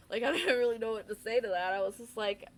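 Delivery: loud, amused talk breaking out of a laugh; real laughing. Real, not acted. Laughs at own lame reply.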